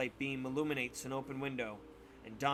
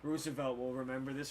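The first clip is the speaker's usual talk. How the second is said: game message voice; 3 dB quieter, faster than usual. Retold or imitated. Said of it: "flashes over the screen".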